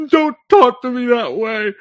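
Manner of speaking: high, loud indignant woman; shrill it out as the hurt wife; offended woman. Retold or imitated. Wife scolding husband, high offended voice. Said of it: "the woman's like".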